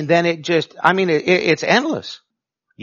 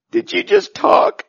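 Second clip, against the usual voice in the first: squeaky, much higher, laughing through it; 5 dB louder than usual. Shown as a demonstration. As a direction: ask it while cracking up. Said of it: "You want laughter?".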